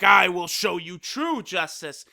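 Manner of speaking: put-on voice of a righteous fighter; Toppo. Imitating an upset fighter vowing justice, put-on heroic voice. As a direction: declare it like a cartoon justice hero. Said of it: "So he's like".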